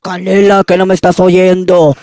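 loud, gruff mock man's voice. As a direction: bark it like a tough guy. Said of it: "se me salió la voz de hombre".

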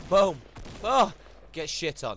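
high, loud victory shout; celebrating a kill; triumphant yell. Real, not acted. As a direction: roar the kill.

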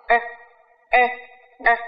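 "e" said very light and quick; teacher drilling the weak schwa; model schwa. Shown as a demonstration. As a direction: say "e" fast and weak, barely there. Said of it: "Đọc rất là nhanh".

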